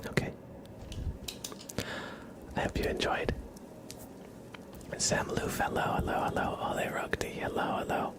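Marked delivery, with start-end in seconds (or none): none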